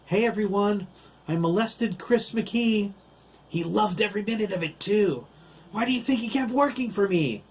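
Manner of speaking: shouted high, mocking the boy to the whole park; gloating taunt. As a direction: yell it out fast like a cruel bully. Giant villain taunts captive boy.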